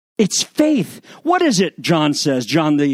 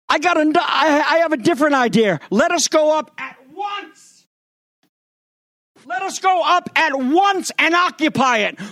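bold rallying call; 9 semitones higher than usual. Acted. Caleb urges the people boldly.

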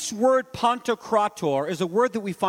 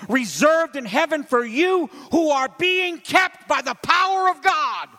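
chanted, rising sermon reading; intoned scripture, swelling at the end; preaching scripture; 6 semitones higher, 3 dB louder than usual. Acted. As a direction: build the verse up to a ringing peak. Reads scripture in preaching cadence.

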